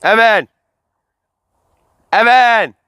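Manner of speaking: loud high call across the yard. Real, not acted. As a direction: shout his name to find him. Calling for missing friend.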